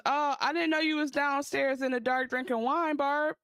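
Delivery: high, teasing husband voice caught off guard; mock husband. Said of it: "he goes".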